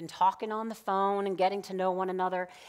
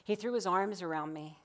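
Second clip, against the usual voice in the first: ordinary talk, nothing marked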